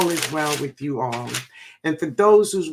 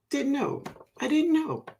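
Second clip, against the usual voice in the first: surprised; 9 semitones higher, 6 dB quieter than usual. Real, not acted. Surprised a viewer lives in Louisiana.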